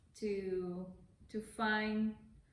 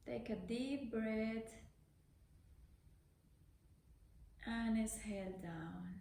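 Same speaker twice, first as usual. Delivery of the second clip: unhurried meditation voice with long pauses; guided meditation; 6 dB quieter than usual. Real, not acted. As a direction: gently guide the breath, leaving space after each phrase.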